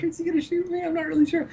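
very high, soft falsetto; tiny high voice for a nervous inner thought; squeaky worried thought. Acted. Vince eyes the gun, squeaky worried thought.

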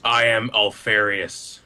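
slow, ponderous introduction; glacial. Acted. Robot introduces himself to the newcomers, glacial and slow. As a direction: say it like large mountains moving. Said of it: "it's very glacial, like, m-, large mountains moving".

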